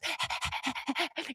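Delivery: quick, soft, voiceless pants; puppy panting. Retold or imitated. Puppy jumping out of woman's arms, panting. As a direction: imitate an eager puppy panting.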